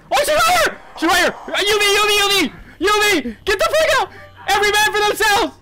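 high, frantic shouted warnings; panicked yelling. Real, not acted. Player telling everyone to flee, panicked yelling. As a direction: yell the alarm in a high panic. Monster arrives, team flees.

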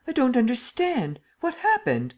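very high, agitated questioning; baffled, voice shooting upward; bewildered alarm. A narrator's reading. The Emperor cannot understand Lupin's collapse, bewildered alarm.

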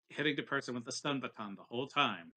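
ordinary talk

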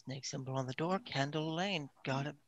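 soft, under-the-breath note to self; quiet repeating. Acted. Oz repeats the bartender's directions to himself, quietly.

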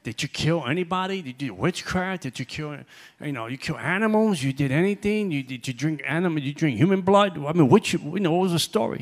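loud, rising string of pointed questions; grilling questions. Retold or imitated. To a former high priest, loud incredulous questioning. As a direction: fire questions at the ex-Luciferian. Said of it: "I said".

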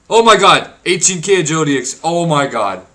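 loud, high disbelief; real amazed shout; stunned excitement. Real, not acted. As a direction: gush at the big reward. Huge experience reward.

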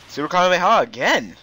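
ordinary talk

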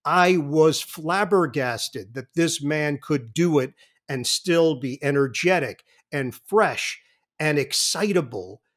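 ordinary talk, nothing marked

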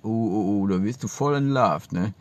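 ordinary talk

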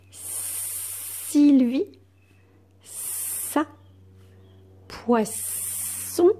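long hissed "sss" in Sylvie, ça and poisson; stretched S sound. Shown as a demonstration. Teacher reads the first-column words to pupils, S drawn out. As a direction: hold the S in Sylvie, ça, poisson before releasing the vowel. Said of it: "pour faire le son S".

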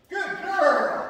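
delighted laugh at the dog; laughing praise. Real, not acted.

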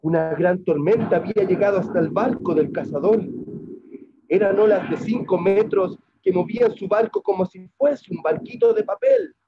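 loud, forceful storytelling; big-voiced tale of peril; dramatic storm narration. A narrator's reading. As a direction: project it over a raging storm.

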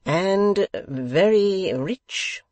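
puffed-up host prompting his guest; pompous questioning. A narrator's reading. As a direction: savour each word grandly.

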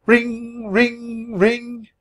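high sung ring ring ring; high mock telephone ring; phone ring. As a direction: imitate a ringing phone, high. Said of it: "the phone rang".

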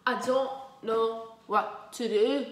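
slow, loud bored complaint; bored moan, heavily drawn out. Real, not acted. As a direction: drag out every word in boredom. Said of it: "I'm just so bored".

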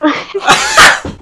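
squealing burst of laughter; high-pitched laugh. Real, not acted. Cracking up at being tricked.

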